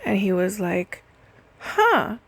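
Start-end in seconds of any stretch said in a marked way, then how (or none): 1.6-2.2 much higher, louder knowing "huh"; hammed-up high surprised grunt; amused "huh". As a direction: mimic the caller's pleased little discovery. Caller reacts, amused and knowing.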